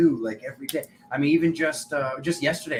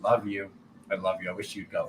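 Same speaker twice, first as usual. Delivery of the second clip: softer and lower, sugary loving tone; tender; 5 semitones lower, 4 dB quieter than usual. Retold or imitated. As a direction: beam love at her while urging her on. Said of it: "I'm just looking at her going".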